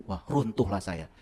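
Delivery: normal talking voice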